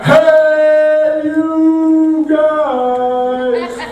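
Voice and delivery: high sung Sloth-like call; Goonies impression. Comic quoting the scary lady, high sung cry. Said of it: "she's like".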